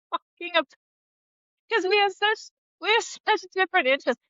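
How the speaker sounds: high, quick, laughing through it; laughing about her husband's idea of hanging out. Real, not acted. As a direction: rush it out between giggles.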